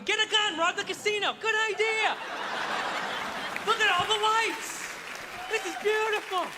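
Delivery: high, delighted, easily led voice; gullible tourist giving in. Acted. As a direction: sell every bad idea as a great one. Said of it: "You're like".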